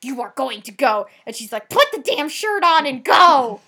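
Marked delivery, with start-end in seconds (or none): 0.0-1.1 loud, scolding outburst; going off. Retold or imitated. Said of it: "just goes off on him". 1.7-3.6 imitated furious yell, higher and very loud; going off. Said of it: "just goes off on him".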